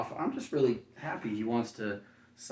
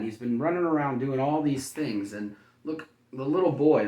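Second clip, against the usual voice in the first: usual speaking voice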